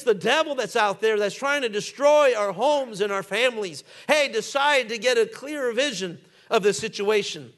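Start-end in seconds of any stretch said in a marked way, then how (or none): none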